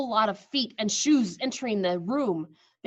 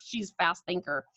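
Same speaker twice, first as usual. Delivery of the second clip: usual speaking voice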